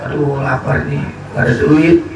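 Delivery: low-pitched hungry lament; acted low, hungry complaint; hungry man's grumble. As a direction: grumble to yourself on an empty stomach. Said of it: "Perutnya keroncongan".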